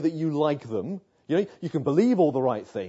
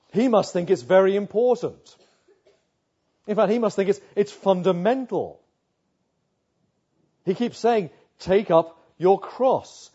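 raised, louder pulpit voice driving the point home; forceful preaching; 4 semitones higher, 6 dB louder than usual. Acted. Pastor urging the crowd to take up their cross, full-force preaching. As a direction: preach it hard, as if to a packed hall.

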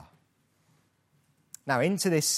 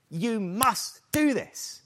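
slow, firm laying down of the law; a hint of a stern boss; mock stern command; 6 semitones higher, slower than usual. Preacher shows the command Paul avoids, mildly stern. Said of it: "Paul is not putting his foot down".

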